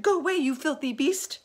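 high, loud furious shout. A narrator's reading. Travellers shouting down at the shark. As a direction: drive the beast off with a yell. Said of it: "they shouted".

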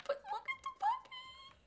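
very high, soft cooing at the puppy. Real, not acted. Baby talk at puppy.

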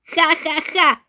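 a smug bully's fake laugh; gloating laugh. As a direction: laugh in her face, schoolyard style.

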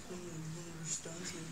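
very quiet, slow sulky mumble; sulky teen. Retold or imitated. Sulking at uncle in the passenger seat, sulky mumble. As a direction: grumble it softly, like a sulking teenager. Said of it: "I was really sulky".

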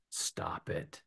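very soft whispered order; quiet, voiceless punchline; hushed command. Real, not acted. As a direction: drop right down to a whisper. Repeated punchline advice.

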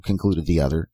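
ordinary delivery, nothing marked

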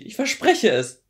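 high, loud, urgent denial; pleading cry. Acted. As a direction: call out the promise desperately. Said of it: "rief sie".

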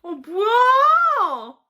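크게 늘어지는 놀란 외침; 진짜 놀라서 길게 소리쳐. Real, not acted. Shocked shout at reality show scene. (길게 끄는 놀란 고함)

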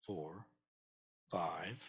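ordinary delivery

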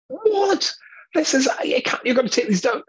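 sudden high, loud outburst of disbelief; high, loud cry of dismay; shocked protest. Retold or imitated. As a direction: jump up in pitch, aghast at the posters. Shocked objection to the host.

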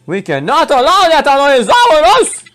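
high, loud protest slowing on the name; hammed-up heroic objection; dramatic rallying cry. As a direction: call out grandly, stretching the name. Rallying a game character in play.

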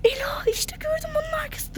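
excited hushed whisper; whispering while hiding. Pretend. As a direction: point it out in a whisper. Spotting the seeker while hiding.

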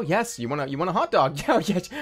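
normal talking voice

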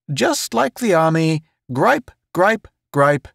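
high-pitched grinning banter; good-natured joker. A narrator's reading. Town joking to the other two, good-natured high voice. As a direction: joke along in a high, genial voice. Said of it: "said good-naturedly ... He grinned".

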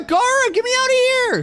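very high-pitched urgent cry; calling for help. Acted. Trapped kid to friend outside, calling for help. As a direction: squeal for rescue.

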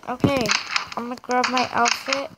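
normal talking voice

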